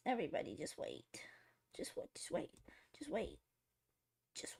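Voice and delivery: hushed whisper, fast. Real, not acted. Streamer whispering to chat while concentrating. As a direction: whisper so you can focus.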